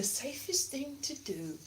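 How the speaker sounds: whispered aside; whispering. Acted. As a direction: whisper it secretly. Dropped to whisper.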